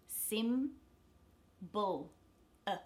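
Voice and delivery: SIM-BUL slowly in two pieces, then a bare uh; spaced SIM-BUL, weak vowel isolated; syllable breakdown. Shown as a demonstration. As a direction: pull SIM-BUL apart with a schwa second vowel. Teacher splits word, isolates schwa.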